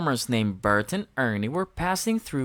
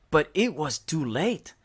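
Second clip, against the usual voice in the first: plain reading voice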